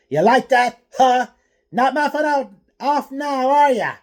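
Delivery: high, loud jeering yell; mock the beaten boy at full volume; taunting shout. A narrator's reading. Bully taunting the fallen Atara, loud high jeer. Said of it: "Nran shouted".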